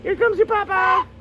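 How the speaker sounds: high sing-song pet voice. Real, not acted. Telling bird owner arrives.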